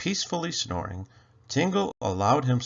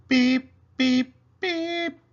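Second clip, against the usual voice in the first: high, sharp electronic beeps; buzzer beeps; 13 semitones higher, 6 dB louder, slower than usual. A narrator's reading. Narrator imitating the departure buzzer with high electronic beeps. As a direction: sound off like a workshop buzzer.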